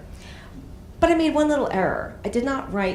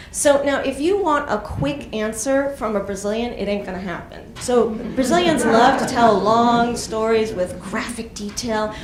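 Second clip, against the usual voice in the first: usual speaking voice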